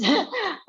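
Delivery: quick high laugh; brief laugh, pitched well up; real laugh. Real, not acted. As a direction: laugh along with the host. Laughing along with host.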